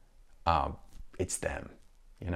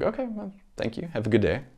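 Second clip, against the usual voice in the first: high, chirpy brush-off goodbye; breezy farewell; 9 semitones higher than usual. Retold or imitated. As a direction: cheerfully wave off the grump. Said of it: "And then they're like".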